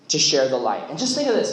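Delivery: ordinary talk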